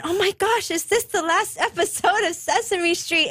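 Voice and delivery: high-pitched mock alarm; retold rising fear. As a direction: relive a sudden dread, acted out. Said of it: "I had this fear rise up".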